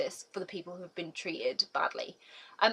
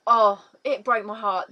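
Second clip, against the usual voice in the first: usual speaking voice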